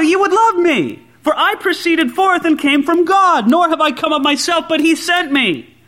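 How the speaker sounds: high, ringing preacher's declamation; forceful scripture reading. Acted. Preacher reading Jesus' words to his enemies, forceful declamation.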